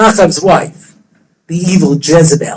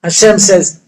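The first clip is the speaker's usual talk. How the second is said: usual speaking voice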